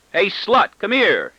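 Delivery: high, loud, eager call across the room; excited call. A narrator's reading. Boy calling excitedly to his sister, high and eager. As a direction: call out, bursting with the news. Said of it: "he calls out excitedly".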